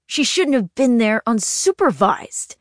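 tight, angry, through the teeth; through gritted teeth. A narrator's reading. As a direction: bite the words back in anger. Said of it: "talking through his teeth".

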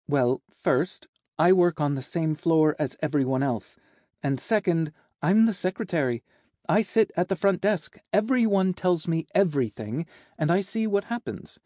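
high, animated secretary voice, climbing as the list goes; knowing secretary. Acted. Secretary explaining how she knows the office, perky and knowing. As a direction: list the reasons with perky confidence.

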